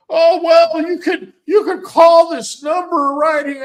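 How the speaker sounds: high, loud mimicry of the dude brushing it off; mocking the delivery guy. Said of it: "dude shows up".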